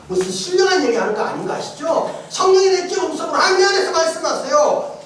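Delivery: ordinary talk, nothing marked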